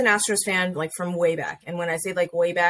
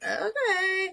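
sheepish; 14 semitones higher, 3 dB quieter than usual. Retold or imitated. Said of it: "And I was like".

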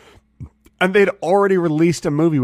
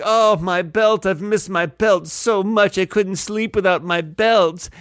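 mock overjoyed; 5 semitones higher, slower than usual. Friend mocking belt owner's joy.

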